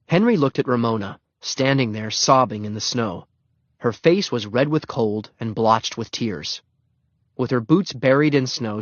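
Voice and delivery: ordinary delivery, nothing marked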